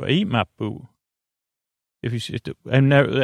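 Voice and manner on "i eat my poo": a light Southern accent on the joke line; mild Southern accent, playful. Shown as a demonstration. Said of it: "it's like a Southern accent, too".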